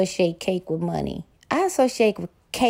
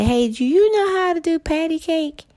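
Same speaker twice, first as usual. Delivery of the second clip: playful high question; 9 semitones higher than usual. Pretend.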